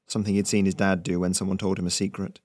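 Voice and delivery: ordinary delivery, nothing marked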